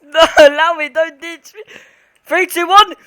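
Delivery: loud, tense countdown; nervous excitement. Real, not acted. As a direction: hype yourself up to go in. Counting down before entering cabin.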